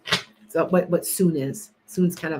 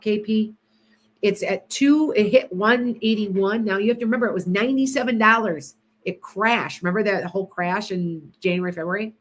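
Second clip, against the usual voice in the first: normal talking voice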